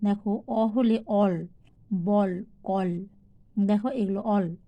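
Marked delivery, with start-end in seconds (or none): 1.1-2.9 অল, বল, কল with a drawn-out open "aw" vowel. Shown as a demonstration. Teacher showing learners the sounds of A, "aw" in অল, বল, কল. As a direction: say অল, বল, কল slowly, holding the "aw". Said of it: "এর উচ্চারণ অ".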